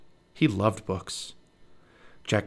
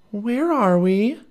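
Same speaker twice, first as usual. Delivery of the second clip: high slow amazed question; wondering Annie; 11 semitones higher, 5 dB louder, much slower than usual. Acted. Annie asks Jack in slow amazement after landing.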